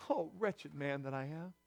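low, soft, heavy quotation; subdued lament. Retold or imitated. Preacher repeating Paul's cry after the outburst, hushed low lament. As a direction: let it sink low and quiet.

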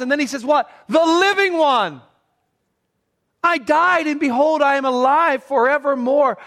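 high, loud proclamation; proclaimed. Acted. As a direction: thunder out the words of the risen Lord. Preacher voicing the risen Christ.

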